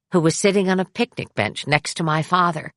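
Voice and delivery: ordinary delivery, nothing marked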